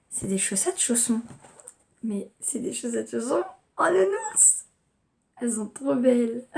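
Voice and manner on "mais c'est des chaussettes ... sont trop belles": higher, rising squeal over a cute gift; gush, lifting the pitch into a delighted laugh. Real, not acted. Vlogger showing off teddy-bear slipper socks, gushing delight.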